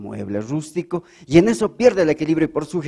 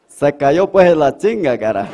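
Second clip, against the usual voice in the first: loud Santa Cruz camba Spanish; camba accent; 8 dB louder than usual. Acted. Woodcutter telling the angel in a loud camba accent. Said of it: "A lo camba".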